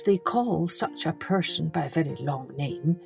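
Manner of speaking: usual speaking voice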